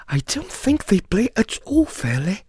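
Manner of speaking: high, plaintive grumble; complaining. A narrator's reading. Alice to the cat about the players, complaining. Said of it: "in rather a complaining tone".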